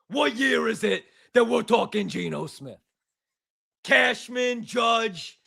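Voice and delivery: put-on thick New York sports caller accent, ranting; mock New York caller accent, bitter. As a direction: rant like a Jets fan calling the radio. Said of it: "Good accent".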